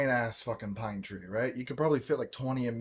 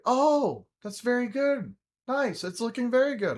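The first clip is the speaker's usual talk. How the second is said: high, loud singsong praise; gushing mother impression; 11 semitones higher, 5 dB louder than usual. Mother praising her child's trimming, gushing mock-mom voice. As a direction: coo approval like an impressed mom, pitched way up.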